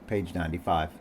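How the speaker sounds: ordinary delivery, nothing marked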